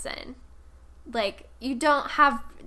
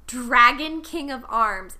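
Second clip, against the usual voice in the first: slow, louder big reveal; dramatic reveal; 6 dB louder, slower than usual. Acted. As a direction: announce it like a grand title. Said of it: "wait for it".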